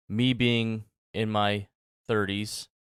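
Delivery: ordinary talk, nothing marked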